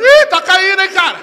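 high, very loud comic alarm; mock warning. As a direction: cry out a warning, voicing your own brain. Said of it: "O cérebro foi avisando". (aviso gritado, agudo e alarmado)

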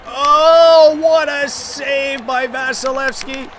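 loud, high drawn-out exclamation; amazed outcry. Real, not acted. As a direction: cry out at an incredible save. Amazed at goalkeeper's save.

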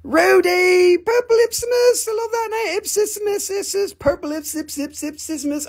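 very high squeaky character; put-on silly voice. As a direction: pipe it up high, easing down gradually. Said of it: "Does this sound like my regular voice?".

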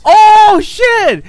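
loud high shocked yell; startled shout. Real, not acted. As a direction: shout in sudden surprise. Chatter arrives, shocked.

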